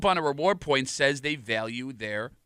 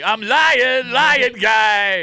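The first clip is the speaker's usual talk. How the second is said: Tommy; 6 semitones higher, 6 dB louder, much slower than usual. Retold or imitated. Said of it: "Not to Tommy".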